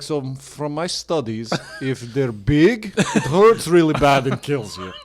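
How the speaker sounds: slow Russian Vlad voice, laughing; hammy Russian accent breaking into laughs. Pretend. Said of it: "He's like".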